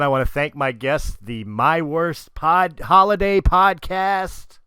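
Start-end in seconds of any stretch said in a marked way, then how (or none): 1.4-4.6 announcer voice. Acted. Announcing the show name.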